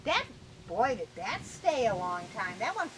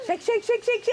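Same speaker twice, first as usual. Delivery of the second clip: excited cheering; 11 semitones higher, 8 dB louder than usual. Real, not acted. Cheering toddler on with bubbles.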